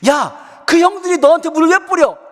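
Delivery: loud, high-pitched grilling; acted-out mom scolding at a high pitch; angry mother. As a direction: tear into the child's flimsy lie. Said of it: "막 화를 내시는 거예요".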